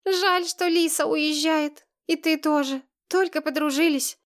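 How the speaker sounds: put-on high, plaintive little-girl voice; understated weepy child; tearful. A narrator's reading. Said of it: "Сергеева хлюпала носом, вытирая слёзы".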